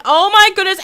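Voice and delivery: usual speaking voice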